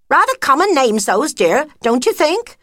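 high, loud disapproving friend; a high-pitched snobbish sniff of a remark; snooty friend mouse. Acted. Friend criticising the cub names, high snooty.

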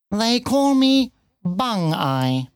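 high, slow cat voice; bitten-off words. A narrator's reading. Cat giving its nickname, words bitten off. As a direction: spit the words out one by one. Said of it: "seemed to bite off its words and spit".

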